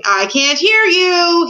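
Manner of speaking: high, loud, slow stretched shout; drawn-out shout. A narrator's reading. King blocking out the mouse, shouting in long drawn words. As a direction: shout it long to drown out the speaker. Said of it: "he stopped to shout".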